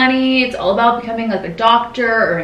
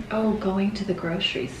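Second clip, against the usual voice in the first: ordinary talk, nothing marked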